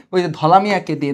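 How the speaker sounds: usual speaking voice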